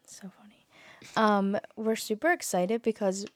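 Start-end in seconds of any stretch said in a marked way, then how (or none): 0.0-0.7 barely audible whisper; whispered aside. Real, not acted. Winding down after a shared joke, whispered. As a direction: trail a whisper after laughing.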